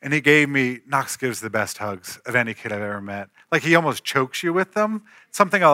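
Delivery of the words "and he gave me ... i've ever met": ordinary delivery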